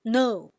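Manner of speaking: loud "no" as a fourth tone; falling tone. Shown as a demonstration. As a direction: drop "no" firmly from the top. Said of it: "goes down from highest".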